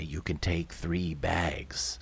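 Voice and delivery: soft, drawn-out impassive order; low, slow dismissal. Acted. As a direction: close the matter slowly and quietly. Indifferent movers answer.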